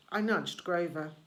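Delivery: ordinary delivery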